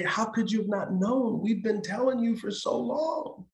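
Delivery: a loving but exasperated plea; lovingly said. Retold or imitated. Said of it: "And I just lovingly say".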